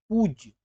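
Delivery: पूज्य with a drawn-out long oo; long vowel. Shown as a demonstration. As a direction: stretch the first vowel of पूज्य. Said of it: "बड़ी ऊ की मात्रा लगेगी".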